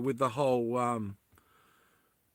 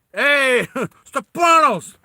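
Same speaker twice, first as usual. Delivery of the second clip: excited greeting; 9 semitones higher, 10 dB louder, much slower than usual. Real, not acted. Greets viewer in live chat.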